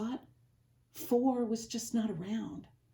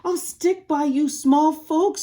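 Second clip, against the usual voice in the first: louder, firm, slowed promise; loyal Horton; 6 semitones higher, 9 dB louder than usual. Acted. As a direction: pledge steadfastly.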